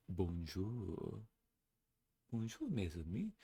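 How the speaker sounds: soft French-flavoured greeting; a hint of a French accent, gentle; lightly French wife. Wife reassuring her husband, light French accent.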